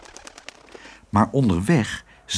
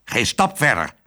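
mildly cross. A narrator's reading. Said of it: "zei Herman nijdig".

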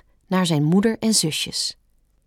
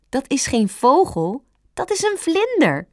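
high little rabbit voice, rising higher; Stampertje correcting; 7 semitones higher than usual. A narrator's reading. Rabbit teaching the fawn about butterflies, correcting. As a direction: correct your friend in a high child's voice.

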